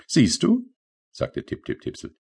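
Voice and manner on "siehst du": high, light little sprite voice; tiny rain sprite. Acted. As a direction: play the small rain creature, pitched up. Tipsel's voice in story. (hohe, leichte Koboldstimme)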